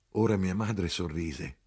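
ordinary delivery, nothing marked

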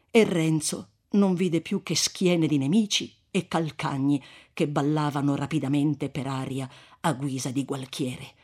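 ordinary delivery, nothing marked

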